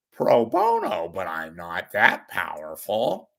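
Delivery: querulous TV commentator with voice cracking upward; Andy Rooney. Acted. Commentator complaining about coffee prices, querulous impression. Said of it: "And that was Andy Rooney".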